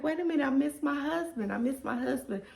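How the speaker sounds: high, fast, pleading whine; squeaky pouty cry of missing the husband; mock whiny longing. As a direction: whine it like you're pining for him. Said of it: "'cause I'm like".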